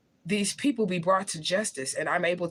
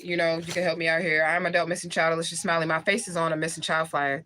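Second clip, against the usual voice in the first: normal talking voice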